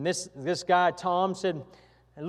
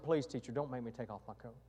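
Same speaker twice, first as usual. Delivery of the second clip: put-on soft, much lower begging little boy; pleading; 7 semitones lower, 13 dB quieter than usual. Poor boy begs the teacher not to whip him, softly pleading. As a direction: beg quietly as a frightened child.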